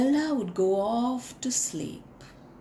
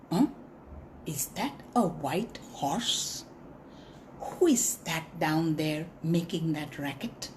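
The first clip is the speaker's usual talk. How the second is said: lower, softer puzzled monkey; 6 semitones lower, 7 dB quieter than usual. Acted. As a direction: peer down and wonder aloud. Monkey peering down.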